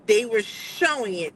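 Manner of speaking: ordinary talk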